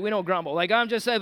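usual speaking voice

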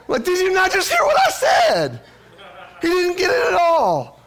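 very high, loud mock-despairing shout; exasperated outburst. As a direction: wail it like a dad nobody listens to. Acted frustration at son missing the point.

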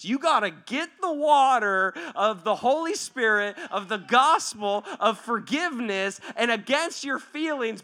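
loud, emphatic exhortation; forceful preaching. Real, not acted. Preacher urging people toward forgiveness, forceful exhortation. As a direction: drive each phrase home like a preacher.

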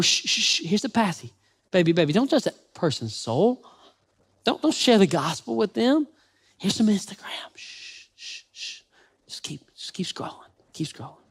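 hushed shushing, fading to a whisper; whispering over a crib. Pretend. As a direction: lull a baby to sleep in a whisper.

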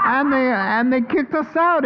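laughing through the words, high; giddy laughing [name]; chuckling at being thrown out. Pretend. [name] laughing about the expulsion.

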